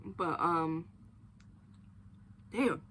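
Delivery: ordinary talk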